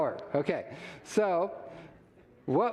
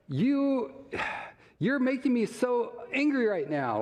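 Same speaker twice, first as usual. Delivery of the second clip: mock angry accusation; 7 semitones higher than usual. Shown as a demonstration. Demo of blaming you statement.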